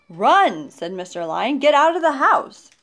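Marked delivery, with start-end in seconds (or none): 0.1-0.7 loud, urgent storybook warning; alarmed warning. A narrator's reading. Man warns of tiger in kitchen. 1.6-2.7 high, loud urgent order; alarmed warning. A narrator's reading. Man warns of tiger in kitchen.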